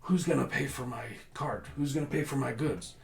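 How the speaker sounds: halfling merchant angrily demanding payment from the guards; angry merchant. Acted. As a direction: demand payment for the broken cart. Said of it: "he's angry, yelling at these guards".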